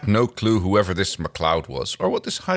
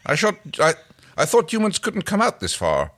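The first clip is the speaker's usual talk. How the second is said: high, louder puzzled warrior; Aeryn voice; 10 semitones higher, 4 dB louder than usual. Acted. Aeryn doubting humans could reach here, high and puzzled. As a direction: pitch it up, baffled and a bit loud. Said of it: "We're just gonna do some voices".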